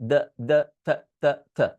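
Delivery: "the" with a voiced th between the teeth; th sound. Shown as a demonstration. Teacher repeating the th sound for students. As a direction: press the tongue between the teeth on "the". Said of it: "উপরের দাঁত নিচের দাঁত চাপ দিয়ে the".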